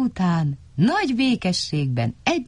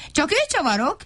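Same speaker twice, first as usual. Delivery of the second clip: indignant shout; 11 semitones higher, faster than usual. A narrator's reading. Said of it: "Kiabált Vacska Matti".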